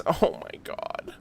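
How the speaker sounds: whispered, slow, overcome; breathless whisper. Real, not acted. Streamer overcome by the cute scene, whispering. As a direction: barely breathe it out. Overwhelmed by cuteness.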